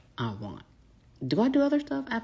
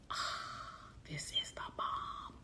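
breathy near-whisper, much softer; blissful sigh; 17 dB quieter than usual. Acted. Coach showing followers her relief at a better way, blissful sigh. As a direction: sigh it out in hushed relish.

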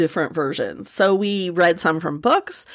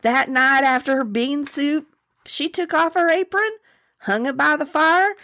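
lilting folktale narration; Southern story narration; 6 semitones higher than usual. Acted. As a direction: spin the tale for the listeners. Reads the story aloud.

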